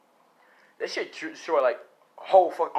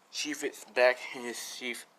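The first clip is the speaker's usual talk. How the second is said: ordinary delivery, nothing marked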